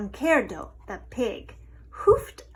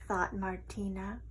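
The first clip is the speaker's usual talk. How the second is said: ordinary delivery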